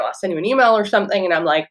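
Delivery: ordinary delivery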